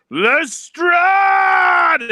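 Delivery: name stretched into a high, loud cry; shake-your-fist theatrical yell at a nemesis; customary angry shout. Shown as a demonstration. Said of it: "hold up your fist in anger".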